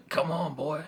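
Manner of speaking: silly mock accent on "boy"; Father Bones. Shown as a demonstration. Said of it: "stupid accent on it".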